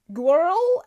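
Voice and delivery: "girl" in a mock Oz Cobb accent, high and loud; amused stab at the Oz Cobb accent; Oz Cobb accent attempt. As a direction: overdo the R in "girl" like Oz Cobb's accent. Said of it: "O R words".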